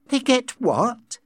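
high, drawn-out impatient question; incredulous and impatient, pitched high. A narrator's reading. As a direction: stretch it out in impatient disbelief. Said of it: "asked impatiently".

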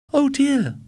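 high, loud gasp of dismay; shocked at her lamp; dismayed wife. A narrator's reading. Sally dismayed.

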